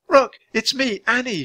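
put-on high-pitched little girl voice; high, earnest girl's appeal; pleading girl. A narrator's reading. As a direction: coax the bird to recognize you. Annie pleads with the bird.

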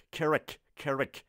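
"کرک" slowly on its own, as "ke-rek"; the first part of the word, "کرک", isolated and repeated; syllable drill. Shown as a demonstration. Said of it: "تلفظ صحیح این لغت".

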